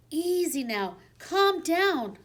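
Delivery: a high squeak, meant to soothe; calm the dog with a high squeak; soothing squeak. A narrator's reading. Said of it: "I squeaked soothingly at the beast".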